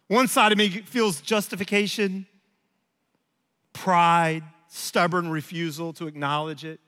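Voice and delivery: usual speaking voice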